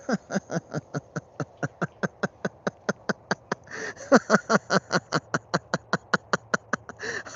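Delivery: long high breathless giggling fit; helpless high cackling laugh; real laughing fit. Real, not acted. Rancher watching cow bother the birds, helpless laughing fit. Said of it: "so sorry for laughing".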